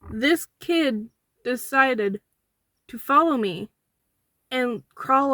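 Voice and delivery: loud exasperated disbelief; indignant. Real, not acted. Indignant outburst about toilet.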